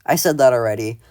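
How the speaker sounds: normal talking voice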